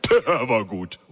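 chuckling, words riding a belly laugh; booming laugh in voice. A narrator's reading. Boss laughing at the joke, amused. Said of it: "dröhnende, tiefe, bauchige Lachen".

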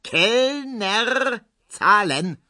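very high, stretched-out call; drawn-out high shout to a waiter. A narrator's reading. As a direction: sing out for the bill across the garden. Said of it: "ruft Herr Ziebland nochmals".

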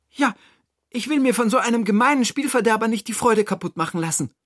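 high, quick, determined voice; resolute Toni. Acted. Toni refuses to let spoilsports win, high and resolute. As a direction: declare it briskly, pitched high. Toni's figure voice.

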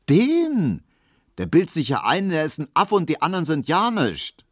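high, loud, dismissive contempt; scornful. A narrator's reading. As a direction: pour scorn on them, pitched high. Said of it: "meinte Hedwig verächtlich".

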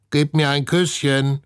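high, loud-edged parrot squawk; croaking. A narrator's reading. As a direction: screech it like a talking parrot. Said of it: "krächzt Herr Klodwig". (hoch krächzende Papageienstimme)

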